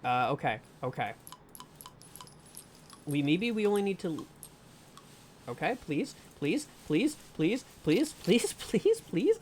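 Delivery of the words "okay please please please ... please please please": rising string of pleas, climbing higher; real nervous begging; anxious pleading. Real, not acted. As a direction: beg nervously, each plea more desperate. Anxious pleading during game.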